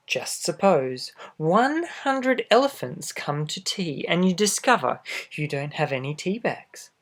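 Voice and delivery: plain reading voice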